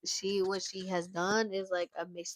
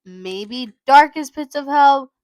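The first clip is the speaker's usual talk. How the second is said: louder, higher, slowed "darkest pits of hell"; loud slow verdict; 6 semitones higher, 14 dB louder, slower than usual. Real, not acted. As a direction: stretch it out like a sentence. Sending him to hell.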